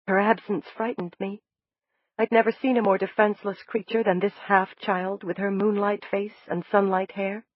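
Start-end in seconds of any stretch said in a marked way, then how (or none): none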